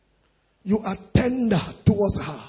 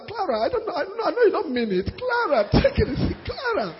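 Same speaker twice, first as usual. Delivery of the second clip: high, coaxing and half-laughing; pleading husband; 4 semitones higher, 3 dB quieter, faster than usual. Acted. Husband pleads with Clara through the door, coaxing. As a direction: soothe her like a sorry husband. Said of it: "he will call her, call her".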